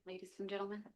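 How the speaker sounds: very soft, barely audible address; too quiet. Real, not acted. Newcomer addressing ladies and gentlemen, too quiet. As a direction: let the words drop away, hard to hear. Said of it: "We can't hear you".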